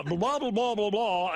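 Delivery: wordless radio-announcer patter; Dan Patrick. Retold or imitated. Host parodies Dan talking on the panel, mock announcer voice. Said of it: "Dan's got an announcer's voice".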